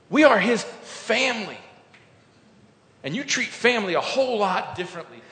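rising preacher's fervour, loud and lifted; full-force preaching. Acted. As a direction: proclaim it to the whole room.

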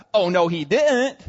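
loud, drawn-out modern-day disbelief; mock outrage. Fellow servants reacting as if today, mock outrage. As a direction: imitate scandalised onlookers, slow and loud. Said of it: "If it was today, they'd be like".

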